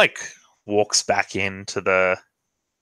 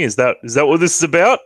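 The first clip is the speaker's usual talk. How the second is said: jealous accusation; 6 semitones higher, 5 dB louder, faster than usual. Acted. Lug accuses Razor jealously.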